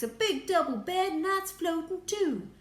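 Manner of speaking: very high, loud marvelling; excited report. A narrator's reading. Astronaut describing the bed to the President, excited. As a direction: pipe it up in amazement.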